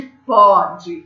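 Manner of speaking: pode slowly, with an open ó. Shown as a demonstration. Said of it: "pode é som aberto".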